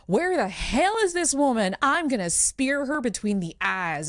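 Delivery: fierce warrior entrance. Acted. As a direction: burst in raising your voice, out for blood. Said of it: "bursts into the grove and says".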